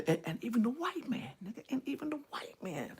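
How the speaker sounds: sudden hushed, guarded imitation; voice drops. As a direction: drop to a very soft, secretive murmur. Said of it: "your, your voice go down".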